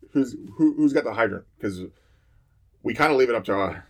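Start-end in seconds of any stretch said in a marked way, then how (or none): 0.1-1.5 mild mock yell to the crew; retold yell. Firefighters checking with each other boarding the truck, retold yell. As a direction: call it out, lightly acting the shout. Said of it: "we'll yell back and forth".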